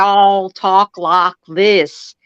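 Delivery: slow mock Southern drawl; imitating a Southern accent. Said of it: "especially in Florida".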